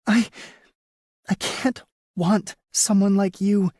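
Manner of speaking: high woman's voice through gritted teeth. A narrator's reading. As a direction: force it out between clenched teeth. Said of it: "through gritted teeth".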